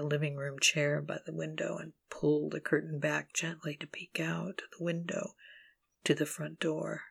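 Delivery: hushed, unvoiced whisper. Crouched behind the chair peeking out the window, whispering. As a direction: breathe the words while hiding.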